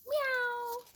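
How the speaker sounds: very high, drawn-out cat meow; kitty meow. Pretend. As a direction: meow like a tiny kitten for a child. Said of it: "A kitty?".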